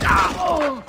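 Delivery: loud, very high startled cry; startled yell. Acted. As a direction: jolt as the fight breaks out. Fight erupts.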